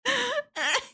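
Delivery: high burst of real laughter; laughing. Real, not acted. Streamer amused at being called American, high laughter.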